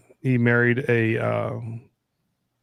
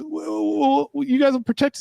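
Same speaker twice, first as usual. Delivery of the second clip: put-on much higher, thin child voice; pleading kid. Kid begging dad for protection, high child voice. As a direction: beg like a small frightened child.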